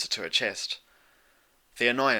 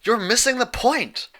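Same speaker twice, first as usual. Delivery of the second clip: much higher and louder, a frustrated cry; frustrated friend crying out; cried out; 7 dB louder than usual. A narrator's reading. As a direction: cry it out in exasperation. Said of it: "Twilight cried".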